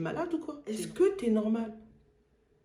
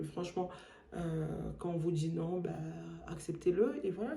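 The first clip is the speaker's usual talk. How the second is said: usual speaking voice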